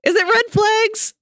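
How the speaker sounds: high, loud excited question. Real, not acted. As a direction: blurt it out thrilled. Excited guess.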